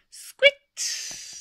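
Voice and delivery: very high, loud squeak; mouse squeak. Acted. Narrator closes the book with a mouse squeak.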